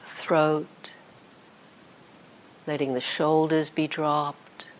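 calm guided body scan with long pauses; unhurried meditation voice, leaving space after each phrase. Real, not acted. Teacher guiding sitters through a body scan, calm meditation voice.

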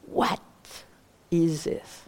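"what" set apart, then a pause; stress on WHAT. Shown as a demonstration. Teacher asks which word is most important, stressing "what". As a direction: lean on "what", let "is this" drop. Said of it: "Should I put some emphasis on what".

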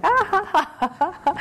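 high, loud burst of mock laughter; imitating hearty laugh. Showing how Sayadaw laughs at whatever he talks about, hearty mock laughter. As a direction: echo the teacher's carefree laugh.

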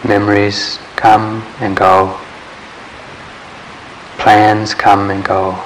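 ordinary talk, nothing marked

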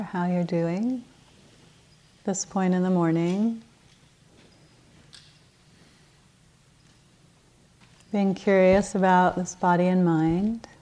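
unhurried meditation guidance with long pauses; guided meditation. Acted. Teacher settles the group into morning practice, calm guided-meditation delivery. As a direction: ease them gently into stillness, leaving space between phrases.

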